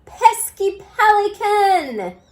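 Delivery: high, loud and slow; cried out. A narrator's reading. Bob the dog wails at the pelican. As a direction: drag it out in despair. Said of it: "cried Bob".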